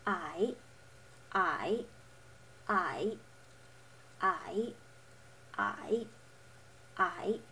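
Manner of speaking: "I" dipping low then rising; third tone. Shown as a demonstration. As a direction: draw a check mark with the pitch on "I". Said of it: "you lower and raise your voice".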